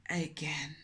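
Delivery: whispered with mock dismay; whispered pity; whispered again. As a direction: drop to a whisper. Dramatic whispered aside.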